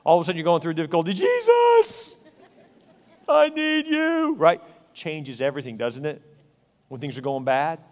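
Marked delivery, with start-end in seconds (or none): 1.1-4.3 high, slow pleading cry to Jesus; desperate prayer. Pretend. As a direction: beg like someone at the end of their rope. Mock desperate prayer in trouble.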